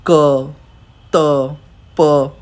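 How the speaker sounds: क, त, प held back without a puff of air; slow, separate, unaspirated क, त, प; tensified consonants. Shown as a demonstration. Teacher showing learners tensified sounds, unaspirated क, त, प. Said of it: "टेंसिफाइड वर्ड हैं".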